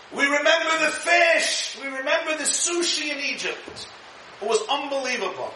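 mock whining crowd begging for steak; whining complainers. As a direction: wail it like spoiled people craving food.

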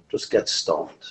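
low, soft, almost whispered advice; throwaway aside. Retold or imitated. Rabbi mimicking casual advice, half-whispered. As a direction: toss it off under the breath.

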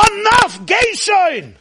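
high furious cry of enough; angry shout. Acted. Hashem losing patience with Moshe, high angry shout.

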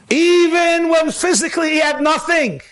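high ringing preacher's call; preaching shout. Acted. Rabbi driving home the lesson, shouting. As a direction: cry it out to the room.

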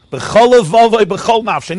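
loud, high burst of fervor; impassioned cry. A narrator's reading. Preacher telling of a boy charging the royal carriage, impassioned. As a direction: belt it out with full force.

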